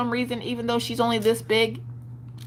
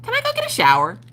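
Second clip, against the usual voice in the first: imitating daughter; 10 semitones higher than usual. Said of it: "she's like".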